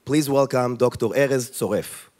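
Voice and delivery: usual speaking voice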